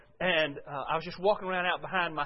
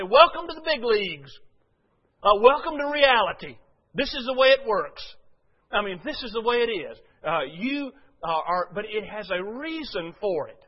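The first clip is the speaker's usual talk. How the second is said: emphatic pastor's reply; 3 semitones higher, 8 dB louder than usual. Retold or imitated. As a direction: boom it out like a wake-up call. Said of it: "And I say".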